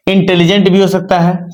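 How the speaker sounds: normal talking voice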